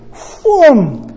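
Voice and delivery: put-on a quick, high swoosh for running kids; whoosh sound effect. Grandpa shows how fast the grandkids sprint, whoosh. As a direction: make the sound of kids shooting off.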